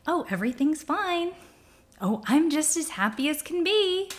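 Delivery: sing-song denial, covering up seething anger; fake cheerful. Speaker voicing someone repressing anger, in fake cheer.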